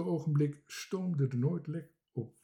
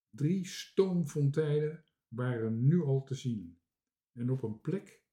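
ordinary delivery

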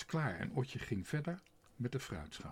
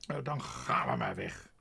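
furious shout; 10 semitones lower, 5 dB louder than usual. A narrator's reading. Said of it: "riep Tos woedend".

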